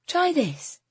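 soft, high and coaxing; gentle. A narrator's reading. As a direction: offer it gently. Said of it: "she said gently".

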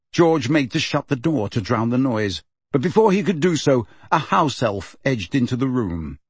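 ordinary delivery

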